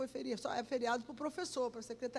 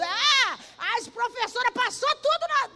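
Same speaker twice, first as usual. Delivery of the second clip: higher and louder, thrilled; excited shout; 10 semitones higher, 11 dB louder than usual. Retold or imitated. Secretary describing the teachers parading, excited shouting. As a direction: cry it out as the teachers march.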